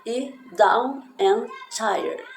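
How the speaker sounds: down and tired drawn out very slowly and loud; slowing the lyric for students; very slow demo. Shown as a demonstration. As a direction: stretch each word out. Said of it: "Esse tired aqui é tired".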